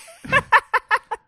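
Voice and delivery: loud, high burst of laughter; incredulous laugh. Real, not acted. Co-host floored by the band ending, loud incredulous laugh. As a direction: crack up loudly in disbelief.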